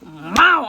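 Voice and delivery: loud mock punch "Mow!"; punch sound. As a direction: smack it out like a hit.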